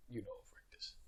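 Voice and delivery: whispering. Acted. Dramatic hushed name-drop.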